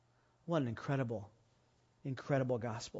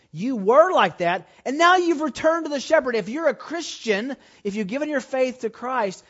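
high, loud preacher's push; full-force preaching; 8 semitones higher, 10 dB louder than usual. Acted. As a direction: drive it home to the congregation.

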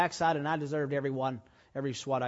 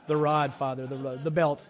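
real laughter breaking into the words; laughing through it; 6 dB louder than usual. Real, not acted. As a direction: chuckle while quoting the answer. Recalling his answer to dad.

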